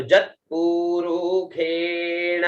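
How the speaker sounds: जत पुरुखेण drawn out slowly; slow recitation. Shown as a demonstration. Teacher repeats जत पुरुखेण slowly for review. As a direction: stretch each syllable of जत पुरुखेण. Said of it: "तो यहाँ तक देखें एक बार".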